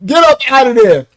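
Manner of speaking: ordinary talk, nothing marked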